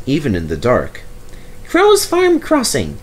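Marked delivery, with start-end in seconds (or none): 1.7-3.0 very high, slow character voice; happy chuntering. A narrator's reading. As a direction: chunter happily in a squeaky-high voice. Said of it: "he chuntered happily".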